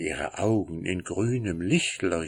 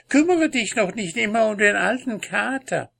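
impatient wife; 3 dB louder, faster than usual. Acted. Wife urges husband on.

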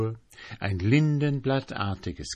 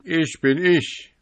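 higher, louder, proud declaration; proud boast; 9 semitones higher, 7 dB louder than usual. Acted. January boasting to the sentry, proud and loud. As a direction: plant your feet and boast. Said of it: "ein ganzer Kerl".

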